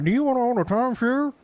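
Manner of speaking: high, blaring timeshare ad; loud commercial voice. Retold or imitated. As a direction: yell the pitch at a sleepy viewer. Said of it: "the volume is oddly loud".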